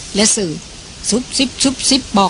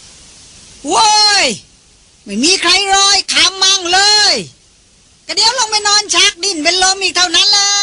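shouting to nobody; 10 semitones higher, slower than usual. A narrator's reading. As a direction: holler it out to no one in particular. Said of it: "แล้วตะโกนขึ้นลอยๆ".

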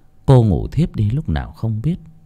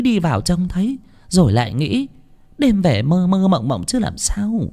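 nagging wife; 6 semitones higher than usual. Acted. Wife lectures husband in bed.

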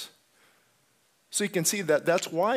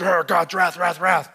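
booming mock voice of an angry God; mock wrathful God; 3 semitones higher, 9 dB louder than usual. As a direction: thunder out a caricature of divine wrath. Mocking a wrathful God caricature.

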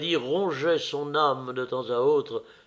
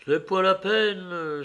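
resigned, sighing higher voice; 7 semitones higher than usual. A narrator's reading. Young man declining to return, sighing. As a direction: sigh it out as not worth the trouble. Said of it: "soupira Adrien Singleton".

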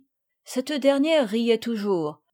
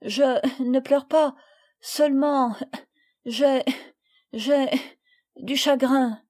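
halting, tearful higher voice; holding back tears; 4 semitones higher, 3 dB louder than usual. A narrator's reading. Camille denies crying to her friends, holding back tears. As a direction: break it up, fighting back sobs. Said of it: "ne pouvant retenir ses pleurs".

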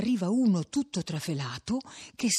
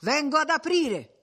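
higher-pitched call to those waiting; 8 semitones higher than usual. A narrator's reading. Sexton answering the crowd outside, calling out. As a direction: call out that you are coming.

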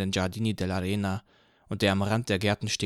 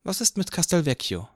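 higher woman's voice; woman asking; 8 semitones higher than usual. A narrator's reading. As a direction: ask it coolly as a woman. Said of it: "fragte eine Frau".

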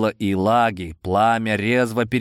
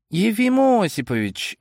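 exclaiming; 11 semitones higher than usual. A narrator's reading. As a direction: exclaim the name in surprise. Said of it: "воскликнул я".

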